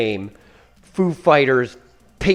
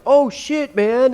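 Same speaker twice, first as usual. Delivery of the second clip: high, loud hammed-up panic; mock alarm; 11 semitones higher, 5 dB louder, much slower than usual. Imitating Dave's running joke in the movie, mock alarm. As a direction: exaggerate the dismay, pitch way up. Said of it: "Dave just being like".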